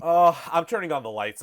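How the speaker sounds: loud, high-pitched panic; scared outburst. Real, not acted. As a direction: blurt it out in real fright. Scared, turning on lights.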